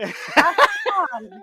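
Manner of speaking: CATAN said high and loud, through a laugh; emphatic pronunciation demo. Shown as a demonstration. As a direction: laughingly insist on Catan, full "cat" first syllable. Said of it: "Catan, not Catan".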